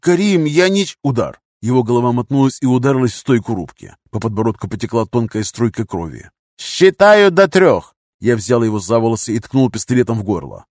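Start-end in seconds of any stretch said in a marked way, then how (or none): 0.0-1.0 high, louder, pleading start; cut-off plea. A narrator's reading. Captive pleading with his captor before a blow, high plea. 6.6-8.0 high, slow, loud ultimatum; loud threat. A narrator's reading. Gunman giving captive an ultimatum, loud threat.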